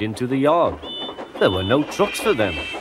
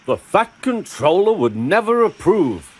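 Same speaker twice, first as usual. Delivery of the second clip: higher, huffy objection; 7 semitones higher than usual. A narrator's reading. Gordon objecting to running on branch lines, huffy. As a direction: huff it out in disapproval. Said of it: "huffed Gordon".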